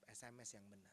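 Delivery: ordinary delivery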